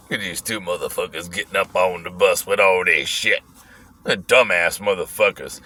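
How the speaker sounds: put-on low, grumbling old bus rider; put-on old man's voice, pitched well down.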